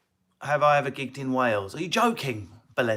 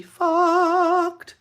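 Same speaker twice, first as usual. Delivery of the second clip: very high, slow squeal; squeaky punchline; 8 dB louder than usual. Acted.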